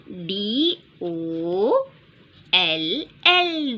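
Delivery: letters drawn out one by one; slow spelling out. Shown as a demonstration. Teacher spelling a word slowly to children. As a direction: spell it slowly for small kids. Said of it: "अब देखेंगे डॉल का spelling क्या है".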